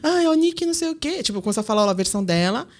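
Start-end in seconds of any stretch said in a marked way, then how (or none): 0.0-1.2 high mock whining complaint; retold tearful tale-telling, lightly put on; high-pitched imitation of a weepy sister; imitating crying sister. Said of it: "Ela chorando horrores".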